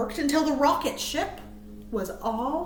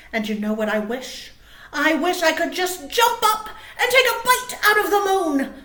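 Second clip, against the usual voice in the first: Bear voice; 4 semitones higher, 6 dB louder than usual. Acted. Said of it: "replied Bear".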